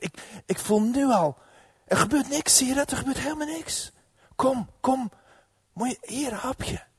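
high, eager persuasion; excited coaxing. Acted. Vrouw haalt man over. (hoog en enthousiast overhalend)

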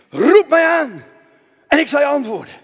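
loud, high declaration of scripture; booming proclamation. Acted. Preacher voicing God's promise to the king, loud proclamation. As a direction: proclaim it as God's own call.

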